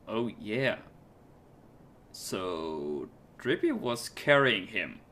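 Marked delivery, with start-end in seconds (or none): none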